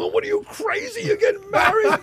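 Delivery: high, laughing mock outrage at the news; mock disbelief. Friends ribbing him about getting married, high laughing mock shock. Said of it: "my friends go".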